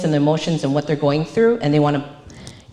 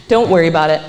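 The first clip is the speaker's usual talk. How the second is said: dismissive; 5 dB louder, much faster than usual. Retold or imitated. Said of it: "that's what someone's gonna respond with".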